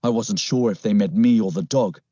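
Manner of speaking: ordinary delivery, nothing marked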